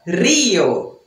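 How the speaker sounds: Rio with a strong trilled Spanish R, like rrio; dramatic trilled R on Rio. Shown as a demonstration. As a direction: Rio with a bold trilled R, mouth wide open. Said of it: "Não estou exagerando".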